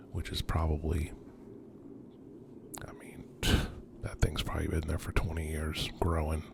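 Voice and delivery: hushed whisper while exploring; nervous night vlog whisper. Real, not acted.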